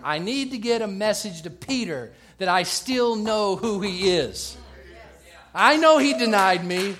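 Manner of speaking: forceful, driving pulpit delivery; impassioned preaching. Acted.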